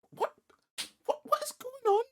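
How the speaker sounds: high squeaky disbelief; what on earth, shrieked; shocked disbelief. Real, not acted. Shocked at the post.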